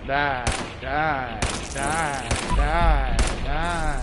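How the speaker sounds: loud battle yell; furious yelling. Real, not acted. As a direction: scream die with each hit. Shouting while attacking.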